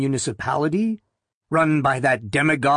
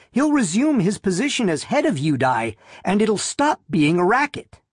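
put-on higher-pitched, insistent young believer; Loretta; 6 semitones higher than usual. A narrator's reading. Loretta defending her belief, higher-voiced and insistent. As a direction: pitch it up and press the point. Loretta argues back in dialogue.

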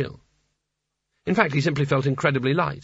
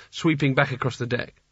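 plain reading voice

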